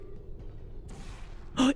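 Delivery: long, breathy intake of breath; excited gasp. Real, not acted. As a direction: gasp in anticipation. Reacting to game event.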